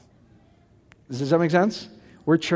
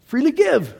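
emphatic high cry; 9 semitones higher than usual. Real, not acted. Preacher stresses God's free giving.

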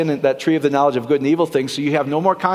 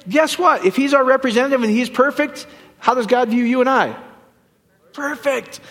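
ordinary delivery

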